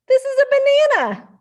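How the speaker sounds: high loud squeak; squeaky confident. Acted. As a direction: squeak it out proudly. Mouse wrongly names snake.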